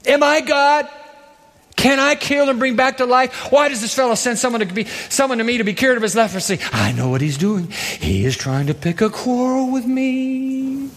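high, rushed, fretting king, a touch of Colonel Klink; panicked king. Acted. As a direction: play a frantic paranoid ruler. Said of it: "Sounds like Colonel Klink".

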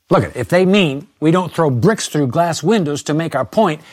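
normal talking voice

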